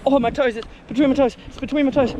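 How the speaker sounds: high, loud pained cry; pained yelp. Real, not acted. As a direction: yelp from hot sand in toes. Sand burning between toes.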